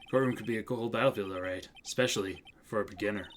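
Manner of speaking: low, soft, unhurried mentor voice; cool, menacing mentor. Acted. Mentor warning his young colleague before testimony, coolly. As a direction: coach the rookie coolly, down low. Plays mentor advising Mia.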